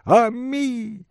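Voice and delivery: high, drawn-out cry; lady lamenting her pet; wailing lament. Acted. Дама оплакивает собачку. (высокий протяжный плач дамы)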